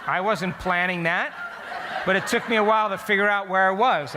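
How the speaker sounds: can't keep the laugh out of it. Real, not acted. To his audience about waking between cucumbers, laughing.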